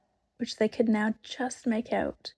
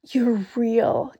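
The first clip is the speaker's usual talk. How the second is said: breathless joy; 3 semitones higher, 4 dB louder, slower than usual. A narrator's reading. Lucy overjoyed at Aslan.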